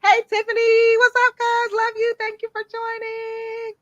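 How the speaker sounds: high, bright hello to a viewer; excited greeting. Real, not acted. Host greeting cousin Tiffany in live comments, high excited. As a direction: greet her like family.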